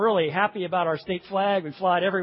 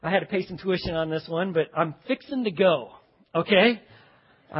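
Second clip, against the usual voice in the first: normal talking voice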